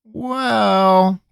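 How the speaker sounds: a long hesitant well; slow. Acted. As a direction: drag it out, stalling. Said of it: "said slowly".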